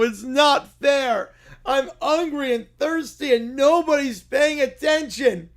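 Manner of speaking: put-on high, slow, whining toddler bear; whiny little kid. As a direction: whine like a tired child who wants his mommy.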